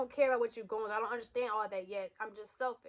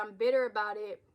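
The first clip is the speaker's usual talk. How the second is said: usual speaking voice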